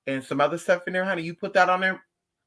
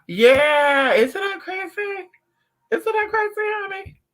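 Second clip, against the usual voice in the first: much higher, squealing disbelief; excited disbelief; 5 dB louder, slower than usual. Real, not acted. Answering a chat comment about her diet, high and amazed.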